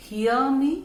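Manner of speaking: "hear me" with the h sound clearly audible. Shown as a demonstration. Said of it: "एच alphabet clearly आपको mention".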